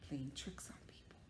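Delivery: sneaky whisper. Acted. Teacher whispering about the dad's tricks. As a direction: whisper it like a mischief secret.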